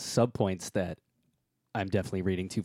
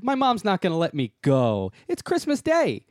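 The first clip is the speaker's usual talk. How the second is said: put-on whiny kid voice; 8 semitones higher, 4 dB louder than usual. Kids voiced whining about Christmas.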